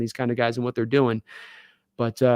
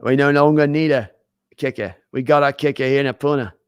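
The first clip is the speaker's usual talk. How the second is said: put-on playful Australian accent. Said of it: "It's my Australian accent".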